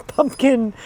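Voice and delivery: ordinary delivery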